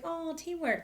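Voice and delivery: high-pitched adoring squeal; delighted, cutesy high voice; gushing coo. Retold or imitated. Gushing over band's teamwork.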